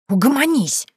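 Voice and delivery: high-pitched telling-off; sharp shushing. A narrator's reading. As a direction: shush him with a high, cross command. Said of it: "шикнула на него Василиса". (шикнуть высоко и сердито)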